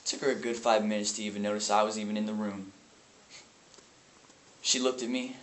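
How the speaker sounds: low, soft, subdued recollection; numb aftermath. Acted. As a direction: drop into hollow quiet after the outburst.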